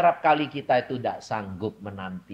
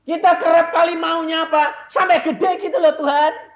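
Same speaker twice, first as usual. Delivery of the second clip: fiery preaching; 14 dB louder than usual. Acted. Preacher to congregation, loud fiery preaching. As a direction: thunder it from the pulpit.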